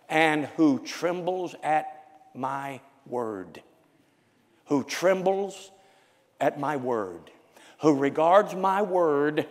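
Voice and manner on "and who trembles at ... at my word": slow, heavy stress on trembles; slow emphatic reading. Acted. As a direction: dwell on trembles.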